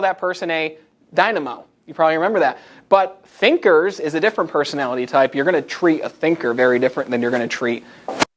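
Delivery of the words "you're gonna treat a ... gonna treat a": ordinary talk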